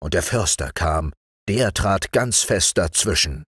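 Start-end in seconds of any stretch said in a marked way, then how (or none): none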